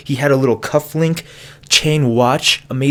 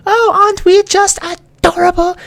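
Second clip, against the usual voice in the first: squeaky sweet high voice, much higher; cutesy high voice; 4 dB louder than usual. Pretend. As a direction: coo it in a tiny high cutesy voice. Showing prom photo, cutesy voice.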